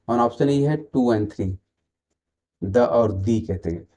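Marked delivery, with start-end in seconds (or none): none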